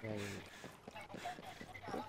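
hushed warning whisper. Real, not acted. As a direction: breathe it out without voice. Whispering an enemy sighting in-game.

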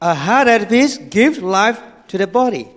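loud, high, emphatic Bible verse; scripture reading. Real, not acted. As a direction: proclaim the verse to the congregation. Reads a Bible verse aloud.

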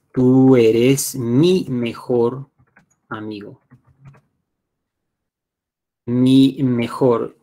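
"Tú eres mi mejor" drawn out, each word separate; slow word-by-word. Shown as a demonstration. Teacher translating for learners, slow word-by-word. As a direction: spell out "mi mejor" very slowly for learners.

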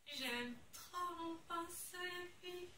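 soft, slow, high and tender. Real, not acted. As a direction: coo over the brush softly and slowly. Tender love for brush. (roucoulé tendre, doux et lent)

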